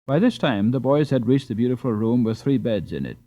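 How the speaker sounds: plain reading voice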